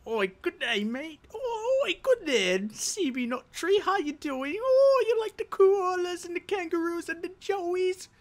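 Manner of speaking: high, hammed-up Aussie accent; goofy Australian greeting to a friend; mock Australian accent. Greeting a viewer in a jokey put-on Australian accent. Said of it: "Dude, I'm Australian for now".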